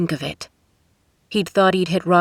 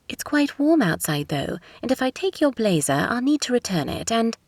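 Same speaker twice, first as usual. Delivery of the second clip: higher-pitched British woman, polite protest; crisp British accent; 6 semitones higher than usual. A narrator's reading. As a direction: reason with him in crisp British tones. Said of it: "in a crisp British accent".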